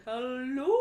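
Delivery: playful whale-call voice. Pretend. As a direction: moan it like a singing whale. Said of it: "I really wanna do the whale voice".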